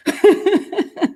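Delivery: a high burst of laughter at herself. Real, not acted. Host laughs at herself for getting mean, high laugh.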